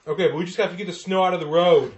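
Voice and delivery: high-pitched pleading driver voice; snowplow driver. Acted. Plow driver reasoning with blocker, high voice.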